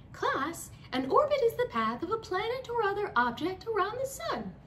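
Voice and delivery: high, lively teacher voice; sing-song lesson voice. Acted. Teacher announcing a fact to her class, bright and high.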